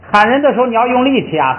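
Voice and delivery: ordinary delivery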